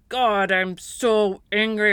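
slow, exasperated cry to God; mock angry prayer. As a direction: vent it slowly, half-acted frustration. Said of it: "even if it starts out with".

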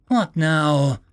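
usual speaking voice

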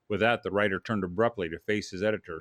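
plain reading voice

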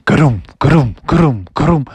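steady, heavy thudding footfalls; imitated footsteps. Wolf footsteps follow the frogs, steady thuds. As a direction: thump out each step at a measured pace. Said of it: "they could hear some footsteps".

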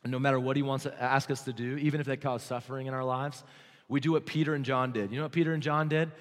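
usual speaking voice

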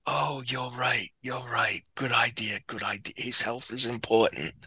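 deflate and concede almost under your breath; sheepish backing down. Retold or imitated. The boss caves to the diabetes excuse, low and half-whispered. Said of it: "And Frank's like".

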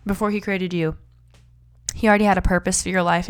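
ordinary talk

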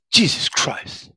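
muttered curse. Retold or imitated. Cursing after hurting finger.

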